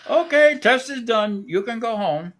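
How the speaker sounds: high, slow mock Indian accent; imitating the Indian technician. Technician waking the patient at dawn, mock Indian accent. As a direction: cheerfully announce the test is over. Technician voiced again in story.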